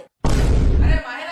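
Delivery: long, stretched loud call of "Mahira". Acted. Husband calls after his angry wife Mahira, long and loud. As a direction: call her back before she leaves.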